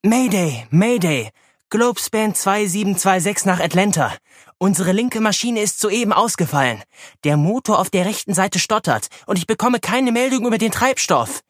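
high, urgent pilot distress call; mayday radio call. Acted. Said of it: "Tracy setzte ihren Notruf ab".

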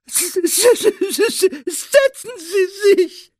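very high, loud tearful elf wail. A narrator's reading. Elf overwhelmed by being offered a seat, wailing. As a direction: stammer and wail it, bursting into tears. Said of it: "jammerte er".

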